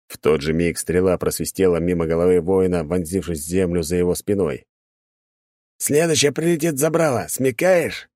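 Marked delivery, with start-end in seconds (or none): 5.8-8.1 growling. Acted. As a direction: threaten him from the window. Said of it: "Снова рыкнул тот же голос".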